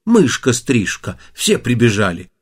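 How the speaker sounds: plain reading voice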